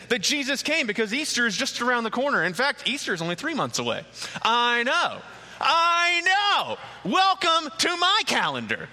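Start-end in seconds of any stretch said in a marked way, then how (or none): 4.4-8.9 high, drawn-out playful "I know"; hammed-up high exclamation to the crowd; mock dismay. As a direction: play up comic disbelief, pitched way up. Joking about Easter being close.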